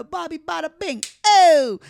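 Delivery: high wiseguy catchphrase, ending in a loud holler; hammed-up mobster impression; Goodfellas wiseguy. Storyteller showing the man's Goodfellas vibe, wiseguy imitation. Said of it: "like a Goodfellas vibe".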